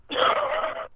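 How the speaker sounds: high cackling laugh. Real, not acted. Laughs after insult.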